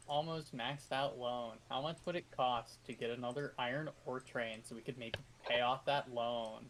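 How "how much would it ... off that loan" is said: ordinary talk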